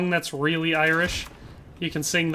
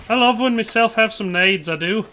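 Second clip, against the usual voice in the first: raised-pitch mock Irish lilt; Irish accent; 4 semitones higher than usual. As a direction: put on a playful Irish brogue. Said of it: "making me read 'em in an Irish accent".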